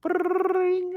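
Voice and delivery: high sung ring-tone imitation; mock phone ring. Calling the man who was run down, high mock ring. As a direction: chirp it like a phone ringing.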